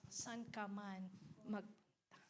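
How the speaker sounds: ordinary delivery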